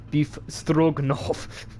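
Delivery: put-on drawn-out Russian accent; slow Russian accent. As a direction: announce your name like a Russian dish. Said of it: "I like to do Russian accents".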